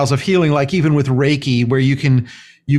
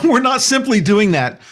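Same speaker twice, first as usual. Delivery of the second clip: ordinary talk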